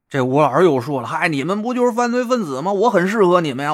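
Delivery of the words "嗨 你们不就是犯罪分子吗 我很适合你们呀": high, quick self-promotion; eager boasting. A narrator's reading. Would-be crook selling himself to the fraud gang, eager. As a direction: pitch yourself brightly and fast.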